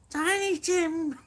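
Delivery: very high squeaky voice, a joke impression. Vlogger asked by chat, squeaking high as [name]. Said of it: "Do them".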